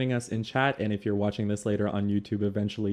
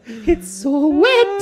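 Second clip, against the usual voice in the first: very high, slow, sung plant voice; sing-song plant voice; 9 dB louder, much slower than usual. Retold or imitated. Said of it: "what would a plant talk like".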